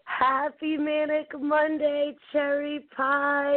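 1 drawn-out greeting. Real, not acted. Host's sing-song greeting.

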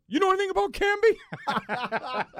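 high-pitched, loud, drawn-out comic plea; mock distraught. Imitating Spike Lee asking him about Camby, mock distraught cry. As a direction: exaggerate an upset fan demanding answers. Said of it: "he looks at me and he goes".

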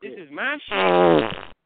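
loud, drawn-out exclamation; excited outburst. Real, not acted. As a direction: belt it out, stretching the last word. Bursting out over a favorite song.

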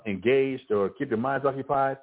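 normal talking voice

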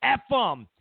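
ordinary talk, nothing marked

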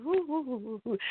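soft breathy woo exhales; real calming-down breaths. Real, not acted. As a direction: blow out the excitement in quiet puffs. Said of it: "Okay, let me calm down, breathe".

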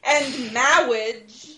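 "marriage" drawn out slowly with a lisp; slow lisping "marriage" like the priest; lisp imitation. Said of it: "he had a lisp as well".

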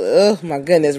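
ordinary talk

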